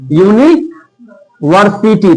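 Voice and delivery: "University" stretched slowly, each syllable drawn out; slowly spelled out. Shown as a demonstration. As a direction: pronounce "university" slowly so the य sound is heard. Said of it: "university का अगर हिंदी में करेंगे". ("University" धीरे-धीरे खींचकर)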